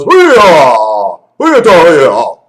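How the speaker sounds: loud mock cheer; voicing the coconut toy with a loud hoo-yah; coconut's cheer. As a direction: shout it out like a gleeful cheer. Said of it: "he goes".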